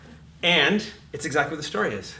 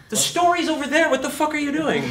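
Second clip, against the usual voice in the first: exasperated director; 11 semitones higher, 4 dB louder than usual. Retold or imitated. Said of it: "the director's like".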